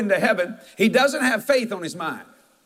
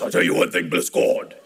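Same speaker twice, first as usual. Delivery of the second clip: much lower, strained imitation of the old preacher; low mock preacher voice; imitating Wallace Key. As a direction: drop way down to mimic the preacher's voice. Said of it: "he talked like this".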